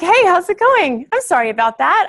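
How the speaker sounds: high, lilting voice of an apologetic shopper; sing-song pitch, lightly acted, easing down; friendly stranger. Acting out apologetic woman in store.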